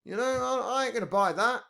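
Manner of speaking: a hammed-up rant as Trump; Trump impression. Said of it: "I'd love to be able to do the accent".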